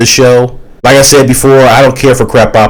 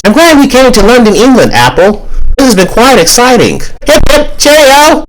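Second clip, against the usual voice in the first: very high, chipper mock British; Onion with British accent; 14 semitones higher than usual. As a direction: squeal it like a cartoon Brit. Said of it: "act all British and whatnot".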